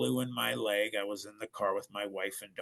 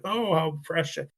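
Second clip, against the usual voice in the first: mock gushing; 6 semitones higher, 5 dB louder than usual. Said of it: "people to just be like".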